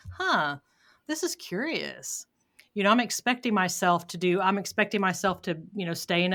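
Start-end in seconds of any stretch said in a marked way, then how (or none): 0.1-2.3 slow, lightly acted musing; detached curiosity. Stepping aside from the inner voice, mildly curious. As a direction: muse to yourself with mild curiosity, unhurried. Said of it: "kind of stepping to the side and going".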